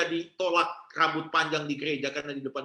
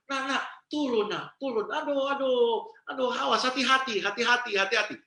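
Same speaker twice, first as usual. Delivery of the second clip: anxious mother; 6 semitones higher than usual. Retold or imitated. Mother anxiously calls child down.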